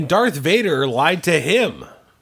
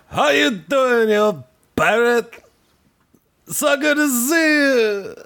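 Lando impression; 7 semitones higher than usual. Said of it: "You sounded a bit like Watto there".

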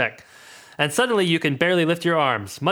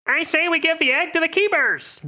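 high character call; Jimjar calling out; 13 semitones higher than usual. Acted. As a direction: pitch it up and call out. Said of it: "Jim calls".

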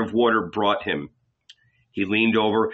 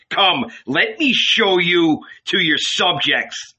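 mocking invitation; 6 semitones higher than usual. A narrator's reading. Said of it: "could not keep from laughing".

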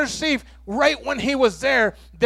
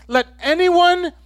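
mildly raised, higher, slow proclaiming voice; quoting in a raised, measured voice; loud proclamation; 6 semitones higher, slower than usual. Retold or imitated. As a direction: call it out slowly to a whole crowd, imitating Jesus. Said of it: "Jesus said in a loud voice".

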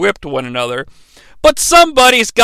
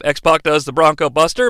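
usual speaking voice

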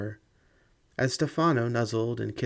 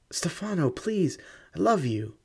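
high, heartbroken plea; pleading; 6 semitones higher than usual. Acted. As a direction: beg him, voice breaking. Begging lover not leave.

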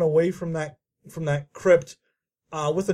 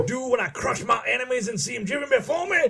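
a Southern gentleman bragging about his conquests; mock Southern accent; 4 semitones higher, faster than usual. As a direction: brag like a Foghorn Leghorn colonel. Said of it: "that southern accent that we do".

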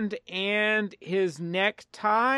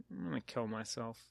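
mock despair; 7 semitones lower, 14 dB quieter, faster than usual. Mock despair over politics.

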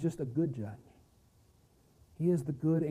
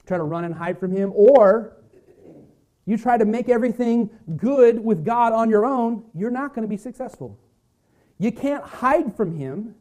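louder, driving preacher's build; rising sermon intensity, hammering the warning; full-force preaching; 5 semitones higher, 6 dB louder than usual. Acted. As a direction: press the point home from the pulpit. Climax of the sermon point.

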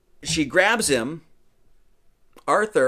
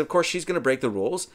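normal talking voice

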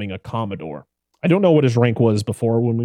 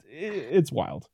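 ordinary talk

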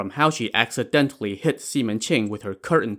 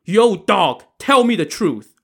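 high, louder barked order; angry demand; 9 semitones higher, 5 dB louder than usual. Acted. Avenging brother threatens old woman, angry demand. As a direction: demand it with full anger.

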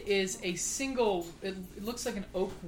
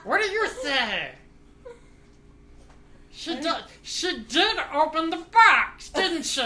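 giggly high fairy voice; 8 semitones higher, 9 dB louder than usual. Acted.

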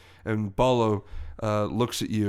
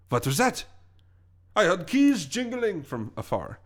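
guard voice; 7 semitones higher than usual. Acted. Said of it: "all of a sudden you hear".

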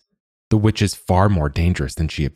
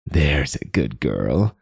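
witchy voice; 2 semitones lower, slower than usual. Acted. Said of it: "the witch says".

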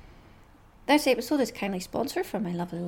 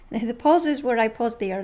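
usual speaking voice

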